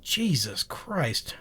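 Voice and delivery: whispered under breath in annoyance; exasperated whisper. Real, not acted. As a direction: hiss it to yourself, fed up. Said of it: "I'm getting annoyed".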